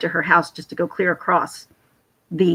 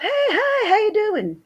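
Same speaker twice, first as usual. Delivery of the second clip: put-on Australian accent; 13 semitones higher, slower than usual. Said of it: "He has an accent".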